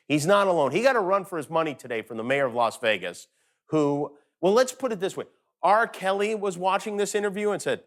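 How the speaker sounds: ordinary delivery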